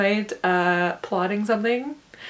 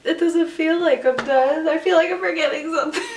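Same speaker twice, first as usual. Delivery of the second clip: higher, fast, half-laughing; giddy disbelief; 7 semitones higher, 3 dB louder than usual. Real, not acted. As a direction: tumble out the worry laughing. After submitting, giddy disbelief.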